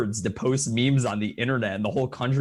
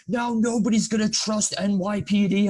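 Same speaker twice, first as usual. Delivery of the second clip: high, drawn-out imitation of ranting; slow, high-voiced mock indignation; mock outraged rant; 11 semitones higher, 3 dB louder, slower than usual. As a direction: imitate someone losing it, pitched way up. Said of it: "he's, like, losing his shit".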